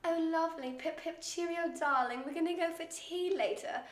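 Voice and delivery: hammed-up posh British, pitched high; posh British accent. Shown as a demonstration. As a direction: put on a playful posh British accent to make a child giggle. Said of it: "the posh British accent".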